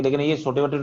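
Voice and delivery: normal talking voice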